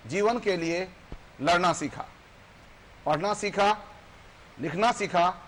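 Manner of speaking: declaimed reproachful letter in verse; poem recitation. Acted. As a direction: recite it in measured phrases, pausing after each.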